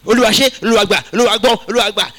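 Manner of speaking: fast, loud rattled-off prayer; mock the noisy babbling prayer; noisy loud praying. Preacher mocking noisy prayers, loud rapid chant. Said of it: "they talk by making too much noise".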